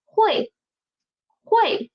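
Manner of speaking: 会 said high, falling fourth tone; model pronunciation. Shown as a demonstration. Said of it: "Read after me, please".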